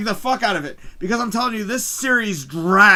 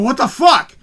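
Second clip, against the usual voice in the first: retold loud, stunned outburst; losing it; 5 semitones higher, 8 dB louder than usual. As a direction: burst out higher and louder in disbelief. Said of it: "I literally start losing my shit".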